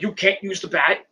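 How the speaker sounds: ordinary delivery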